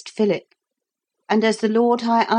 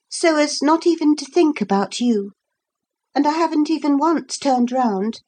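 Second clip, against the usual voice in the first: put-on high, earnest girl's voice; young Lucy; 7 semitones higher than usual. A narrator's reading. Lucy explaining herself to Philip, high girl's voice. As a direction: explain yourself in a higher, lively voice.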